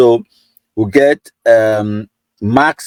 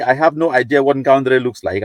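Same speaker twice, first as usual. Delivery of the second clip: ordinary talk, nothing marked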